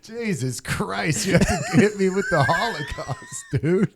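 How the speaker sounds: high, loud exasperation through real laughter; laughing protest. Real, not acted. Called out by co-host for exaggerating, laughing protest. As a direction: protest loudly while cracking up.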